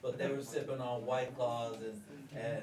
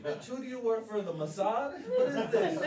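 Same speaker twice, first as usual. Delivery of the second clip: rising, laughing disbelief, louder; mock outrage, pitch climbing to a squeak; incredulous outburst; 10 semitones higher, 5 dB louder than usual. Husband mocking wife's phone check, incredulous. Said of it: "And I was like".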